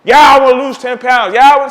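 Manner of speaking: mock whiny voice of people who keep complaining; imitating a complaining client, hammed-up; mocking the gym crowd's excuses, whiny; whiny complainer. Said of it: "tired of you guys always complaining".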